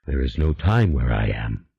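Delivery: low, unhurried otherworldly demon, calm and sure; a patient spirit promising a way back. A narrator's reading. The demon instructs Julien in a low, calm voice.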